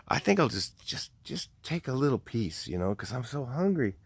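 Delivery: imitating a hungry man sneaking food; tentative temptation. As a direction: quietly talk yourself into a little taste.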